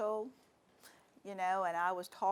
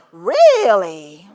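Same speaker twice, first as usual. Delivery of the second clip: one long, loud drawn-out really; retold colleague's loud incredulous really; exaggerated disbelief; 26 dB louder than usual. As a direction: blurt it out in shocked disbelief. Retold colleague hearing about her PhD.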